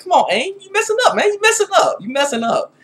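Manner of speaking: high-pitched, exasperated mock scolding; bothered scolding. Reviewer chiding Aang over his fathering, bothered high-pitched scolding. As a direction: plead with him in playful exasperation, pitch way up. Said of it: "I'm bothered by that. I'm serious".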